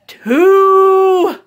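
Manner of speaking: counting two loud and high; playful shout. Acted. Parent counting down to jump, loud and playful. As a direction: build suspense with a big playful count.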